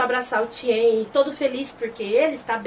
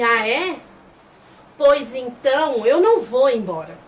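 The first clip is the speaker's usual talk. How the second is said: slow opening, louder stubborn finish; defiant refusal; 4 dB louder, slower than usual. Retold or imitated. Tien refusing to leave after the insult, defiant. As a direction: dig in, stretching the first words.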